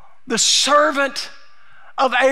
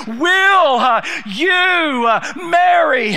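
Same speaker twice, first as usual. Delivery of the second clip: urgent, raised, insistent refusal; 3 semitones higher than usual. Acted. Servant refusing to eat until they answer, urgent. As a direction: refuse to budge, push hard. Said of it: "There's an urgency here in the servant's voice".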